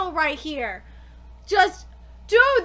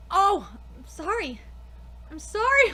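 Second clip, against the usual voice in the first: ordinary delivery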